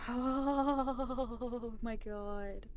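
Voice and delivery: horrified dread. Real, not acted. Player horrified by computer message, long drawn-out dread. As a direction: stretch it out slowly in dread.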